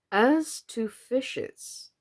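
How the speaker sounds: slow and solemn. A narrator's reading. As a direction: draw it out gravely. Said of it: "very slowly and solemnly".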